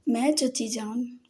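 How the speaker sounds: slow, चची पर ज़ोर, teasing; stress on चची. A narrator's reading. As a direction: lean on the word चची, drawn out slowly. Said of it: "चची पर जोर देता बोला". (चची शब्द पर ज़ोर, धीमे से)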